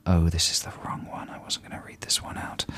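hushed whisper to oneself; whispered aside. Pretend. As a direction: whisper it as if caught out. Stage whisper on reading wrong email.

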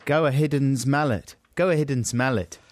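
"Go a Hidden's mallet" said as a South African "go ahead and smell it"; South African accent. Shown as a demonstration. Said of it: "in a South African accent".

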